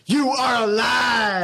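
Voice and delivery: a rallying cry, high, loud and drawn out; preachy shout. Acted. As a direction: belt it out slowly, high and loud like a fired-up preacher. Climax of mock motivational sermon.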